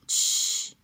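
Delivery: long unvoiced shush; interrupting shush. A narrator's reading. Turtle cuts his mother off, shushing her. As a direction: cut in with a drawn-out "shh". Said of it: "przerwał jej Franklin".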